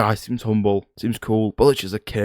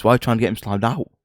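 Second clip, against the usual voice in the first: ordinary talk, nothing marked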